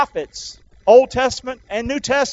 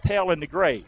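ordinary talk, nothing marked

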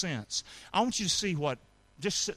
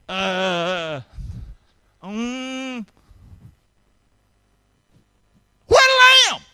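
hesitant uh-uh-uh, then a high shrieking "where the lamb?"; stammering then squeaky yell; 5 semitones higher, 10 dB louder, much slower than usual. Pretend. Preacher hamming up Isaac's stammer and squeaky cry. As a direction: stall nervously, then blurt it out high and loud. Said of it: "Here's it".

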